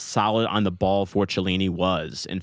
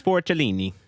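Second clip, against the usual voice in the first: lightly put-on Italian accent, raised pitch; Italian pronunciation; 4 semitones higher than usual. Shown as a demonstration. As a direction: show how an Italian would say it. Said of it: "that's how you say it".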